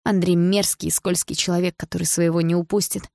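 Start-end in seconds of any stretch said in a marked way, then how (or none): none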